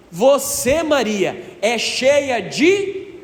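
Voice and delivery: slow, drawn out, rising on de and left hanging; fill-in-the-blank prompt. Shown as a demonstration. Priest leaves the Hail Mary unfinished for the congregation, slow rising prompt. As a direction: stretch it out and wait for the people to answer.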